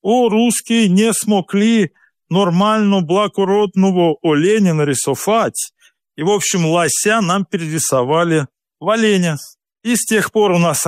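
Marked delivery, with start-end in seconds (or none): 0.0-5.7 slow, higher mock foreign accent in Russian; imitated foreign accent. Foreign heraldists judging a Russian drawing, mock foreign accent. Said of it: "одни иностранцы, они смотрят".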